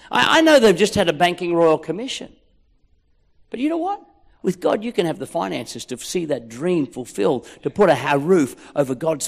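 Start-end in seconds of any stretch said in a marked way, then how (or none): none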